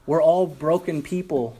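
usual speaking voice

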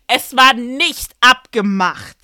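loud, slow "Es war nicht abgemacht"; indignant emphasis. Real, not acted. Sister protesting the unplanned wedding dance, loud indignant emphasis on "nicht abgemacht".

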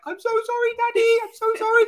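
very high, squeaky puppy voice; the dog apologising to his owner in a tiny pitiful voice; voicing the dog. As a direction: squeak it like a sorry little dog. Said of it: "putting his paw in the air to me".

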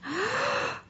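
sharp, high, breathy intake of shock; gasp. Retold or imitated. Mom retelling the spilled juice mid-lesson, sharp gasp. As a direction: suck in air like the mess just happened. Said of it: "And I'm just going".

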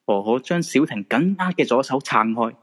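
normal talking voice

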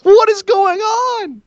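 very high, drawn-out shriek; slow, screeching disbelief; exasperated wail. Real, not acted. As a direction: cry out in comic despair. Exasperated outburst at wrestling show.

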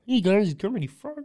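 high frog voice attempt; Kermit voice. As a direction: reach up for Kermit. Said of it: "the Kermit voice".

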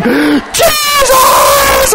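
piercingly high drawn-out cry; re-enacted scream. Preacher overwhelmed at the wheel, screaming to Jesus. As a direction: scream the name with everything in you. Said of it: "I was just screaming".

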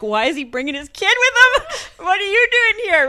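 high, loud laughing imitation of a colleague; a hammed-up, laughing mock of a puzzled colleague. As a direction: play the puzzled colleague while cracking up. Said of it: "they were like".

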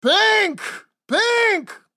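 very high, loud bellow; enraged roar. A narrator's reading. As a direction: scream the name in outrage. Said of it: "Parlow roared".

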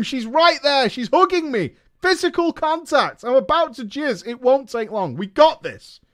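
high, loud pep-talk commentary; rally the team like a coach in the last minute; hammed-up sportscaster build; mock play-by-play hype.

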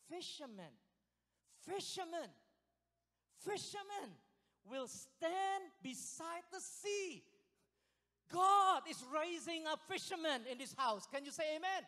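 building to a loud, high-pitched proclamation; full-force preaching. Real, not acted. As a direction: draw out the verse, then rally the congregation loudly.

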